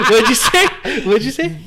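high, loud, laughing question. Real, not acted. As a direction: ask it while cracking up. Laughing at a crude joke.

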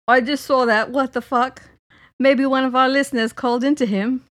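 loud, put-on impression of the co-host; Adam. Teasing co-host with his own texts, mock impression. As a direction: do your friend badly and boldly. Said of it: "This is how you sound to me".

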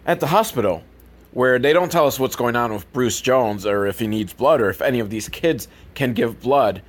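normal talking voice

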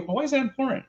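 playing dumb. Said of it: "I'm gonna act dumb here".

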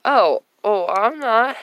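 ordinary talk, nothing marked

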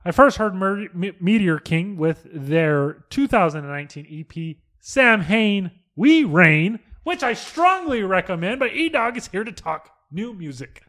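loud, high, slow hyped read; radio host read. Acted. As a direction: pitch the band like a DJ. Reads prepared segment script.

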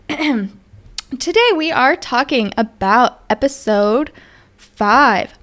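normal talking voice

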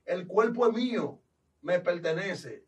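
slow, loud anguished demon cry; screaming demon refusing to leave. Retold or imitated. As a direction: howl it out as a stubborn demon. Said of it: "el demonio gritó".

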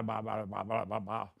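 droning mindless repetition, lightly mocked; mocking babble. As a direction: toss off the words as empty noise. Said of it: "empty phrases".

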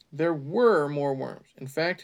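plain reading voice

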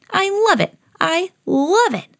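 high-pitched, relishing every word; high glee, stretching the second time; gleeful delight. Acted. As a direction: gush like an overexcited puppy. Dog relishing tug of war.

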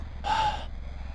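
long unvoiced sigh out; heavy exhale. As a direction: breathe out slowly before deciding. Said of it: "I exhale".

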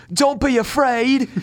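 put-on high, loud, drawn-out British accent; mock-heroic British accent, stretched out; British cat voice. Imitating the cat from the movie, exaggerated British accent. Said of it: "British accent".

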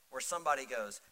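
ordinary delivery

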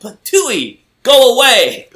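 high, loud mock brush-off with a laugh; dismissive strangers. Hotel guests shooing the knocking stranger, mock annoyed. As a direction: shoo him away, hammed-up. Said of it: "being like".

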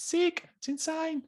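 squeaky high surfer mock. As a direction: pitch way up in jest. Mocking surfer stoke.

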